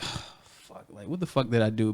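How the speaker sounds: breathy whispered curse, then low and soft; retold dread. Employee to himself after a timesheet call, dreading it. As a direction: mutter it under your breath in mock dread.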